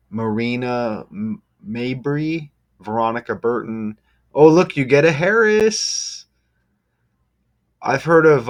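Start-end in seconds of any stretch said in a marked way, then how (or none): 4.3-8.5 sudden jump up, louder and higher; excited. Real, not acted. Vlogger spotting a Harris on the roster, excited. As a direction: pounce on the name with glee.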